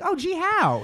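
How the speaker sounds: high-pitched fake wonder; mock curiosity. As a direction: play the gullible reader. Mock reply to silly headline.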